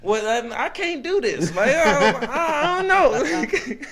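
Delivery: high, stammering mock reluctance; acted nervous hesitation. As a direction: waver high and drag it out, half laughing. Acts out his hesitation, laughing.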